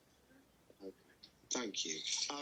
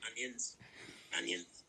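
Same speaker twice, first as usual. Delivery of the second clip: Mister Onions; 4 semitones lower, 4 dB quieter, slower than usual. Retold or imitated. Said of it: "and all he says is".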